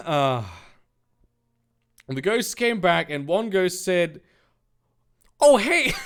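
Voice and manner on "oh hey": put-on high, loud New York accent greeting. Ghost greeting the team in a New York accent.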